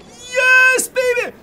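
high, very slow, loud whoop; drawn-out victory cry; triumphant cheer. Real, not acted. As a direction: stretch it out in celebration. Success in game.

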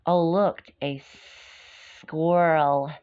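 ordinary delivery

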